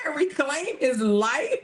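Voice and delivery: high, slowed question breaking into laughter; amused, rising disbelief; incredulous question. Real, not acted. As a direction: repeat it in disbelief, about to crack up. Incredulous repeat of read quote.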